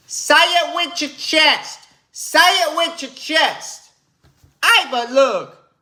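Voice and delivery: high slow sports analyst demanding conviction; Chris Broussard impression. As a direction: stretch it out high and preachy. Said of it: "That sounds just like my man, Chris Broussard".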